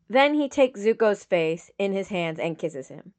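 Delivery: ordinary delivery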